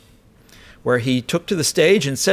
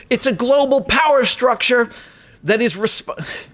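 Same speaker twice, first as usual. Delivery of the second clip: abandoned New York accent impression, pitched up; Trump accent attempt; 8 semitones higher, slower than usual. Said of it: "I, I can't do his accent".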